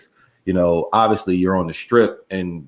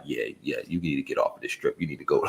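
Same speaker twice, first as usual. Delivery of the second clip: low, hushed bartender's aside; confidential tip; 5 semitones lower, 10 dB quieter, faster than usual. Retold or imitated. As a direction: imitate a bartender quietly tipping off a customer. Acting out bartenders giving insider tip.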